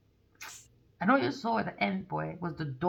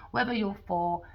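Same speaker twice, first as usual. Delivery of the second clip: usual speaking voice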